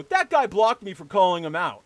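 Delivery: loud imitation of an aggrieved whiner; mock complaint. Imitating a blocked guy complaining to another person, mock aggrieved. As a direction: play the wronged party, exaggerated. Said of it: "I see him reply to another person".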